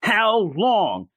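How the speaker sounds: hint of an exasperated shout; mock screaming. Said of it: "And he's just screaming".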